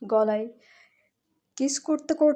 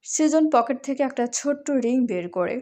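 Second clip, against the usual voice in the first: ordinary delivery, nothing marked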